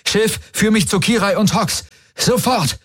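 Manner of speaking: very high, strained-urgent command; urgent call. A narrator's reading. Galen ordering the ship to guide him, urgent call. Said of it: "rief er".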